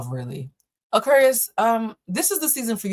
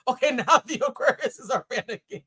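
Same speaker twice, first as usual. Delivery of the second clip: words bubbling with laughter; laughing; 4 semitones higher than usual. Real, not acted. Reader amused by panicking Aquarius viewers, laughing. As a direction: talk through giggles.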